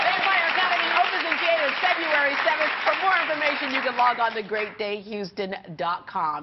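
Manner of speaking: loud, upbeat studio sign-off; TV host promo. Acted. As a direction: sell it to the audience.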